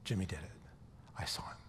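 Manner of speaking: hushed, half-acted tearful confession; mock tearful whisper. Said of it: "She starts crying".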